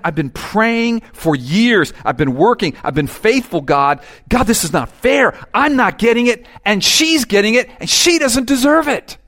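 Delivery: resentful prayer building to high loud whine; grumbling complaint. Acted. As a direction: complain to God that it isn't fair.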